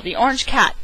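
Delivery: ordinary delivery, nothing marked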